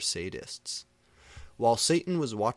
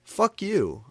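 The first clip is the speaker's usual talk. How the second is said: high, loud snapped curse; furious curse; 12 semitones higher, 8 dB louder than usual. A narrator's reading. Narrator cursing the prep lady, loud furious shout. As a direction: spit it at her.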